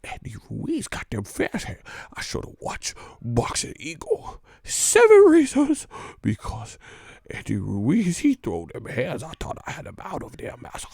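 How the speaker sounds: high put-on nervous servant voice; hammed-up meek excuse; mock servile voice. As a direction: play the cowed fighter pleading with his master. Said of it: "and then Massa walk by".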